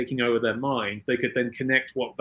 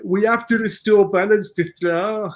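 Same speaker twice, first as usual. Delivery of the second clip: hammed-up Brummy, higher and louder; slow, loud Brummy impression; Brummy accent; 7 semitones higher, 6 dB louder than usual. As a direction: stretch it out in a mock Birmingham accent. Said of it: "speaking in a Brummy accent like [name]".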